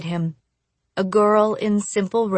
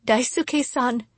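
higher, tentative call to a fallen friend; worried and pitched up; anxious calling; 5 semitones higher, 3 dB quieter than usual. A narrator's reading. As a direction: call out with worry.

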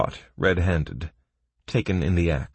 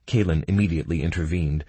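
ordinary delivery, nothing marked

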